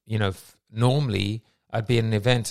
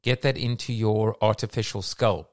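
ordinary talk

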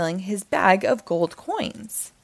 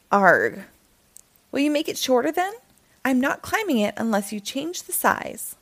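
put-on higher, pleading boy's voice; Jack's protest; 5 semitones higher than usual. Jack pleading with the narrator, higher boy voice.

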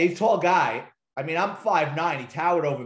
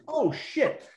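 sudden realization; 10 semitones higher than usual. Retold or imitated. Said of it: "He goes".

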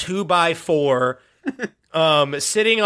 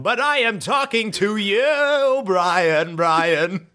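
a hint of a pushy plunger; whiny plunger voice; 3 semitones higher, much slower than usual. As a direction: plead like a clingy gadget. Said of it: "plunger answers".

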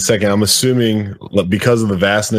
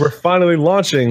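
mock cheerful announcement; 8 semitones higher than usual. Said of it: "it's like".

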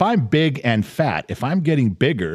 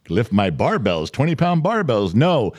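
usual speaking voice

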